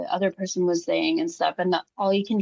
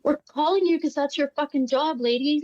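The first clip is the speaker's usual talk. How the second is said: higher, mock-outraged jab at the lady; pitched-up indignant scolding; indignant retort; 7 semitones higher than usual.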